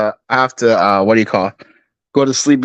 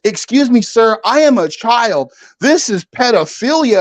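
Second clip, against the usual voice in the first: lightly hammed-up outraged girl; a girl accusing a filmer, mock outrage; indignant girl; 7 semitones higher than usual. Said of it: "the girl proceeds to walk up to him and goes".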